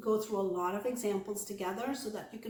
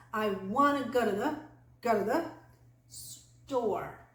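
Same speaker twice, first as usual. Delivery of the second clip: "go to the" run together, "store" set apart; jumbled, stressed WANNA. Shown as a demonstration. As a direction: punch "wanna", blur "go to the", land on "store". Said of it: "jumble that up together".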